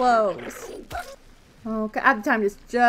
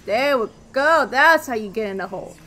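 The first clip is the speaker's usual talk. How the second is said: ordinary delivery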